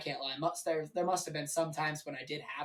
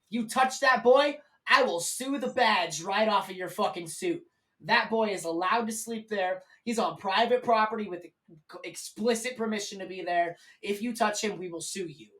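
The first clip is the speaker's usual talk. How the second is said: protective woman chewing out the police; angry threat; 3 semitones higher, 6 dB louder than usual. Retold or imitated. Lady threatens cop on speakerphone.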